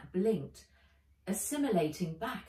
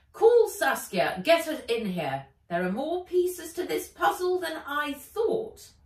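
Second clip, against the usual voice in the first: commanding villain; 10 semitones higher, 9 dB louder than usual. Acted. Ivy ordering her robot to fetch Saskia, loud. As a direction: bark out the order.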